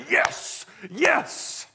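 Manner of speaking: high, breathy cheering yes, yes; a proud parent's thrilled yes from the stands; excited cheer. Shown as a demonstration. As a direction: cheer your kid's big hit. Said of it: "what do I say? I say".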